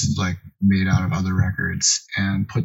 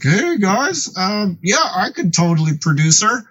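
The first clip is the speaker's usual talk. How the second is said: mock eager kid; 9 semitones higher than usual. Retold nervous kid entering bar.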